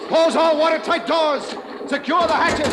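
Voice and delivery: high, loud, slow commands over the flood; shouted orders. Acted. Captain shouting orders to the crew after the hit, loud. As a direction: bellow each order through the flooding boat.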